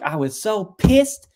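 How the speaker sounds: slow, high, loud mock outrage; retold anger. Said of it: "he was just angry".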